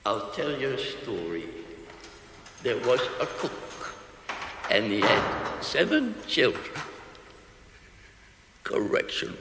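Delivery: dying cook whispering his story; weak and fading. Acted. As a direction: tell it with the last of his breath.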